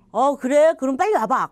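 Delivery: eager surprise. Retold or imitated. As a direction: jump at the good news. Surprised reply to friend's news.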